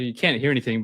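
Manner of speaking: usual speaking voice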